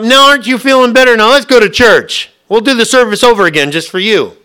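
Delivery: high, loud pushy cheer; mock cheerful insistence. Church folk hauling the sick member to service, loud pushy cheer. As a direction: sell it like a pushy deacon.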